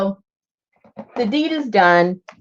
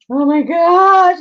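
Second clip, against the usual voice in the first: slow, louder, higher "oh my gosh"; exasperated exclaim; 10 semitones higher, 7 dB louder, slower than usual. Real, not acted. Frustrated at losing the tape, loud slow exclamation. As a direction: let it out in exasperation.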